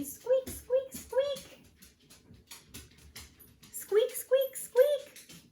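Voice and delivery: tiny, very high chick squeaks; piercingly high little squeak voice. A narrator's reading. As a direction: squeak like something inside an egg. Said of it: "heard a squeaking noise".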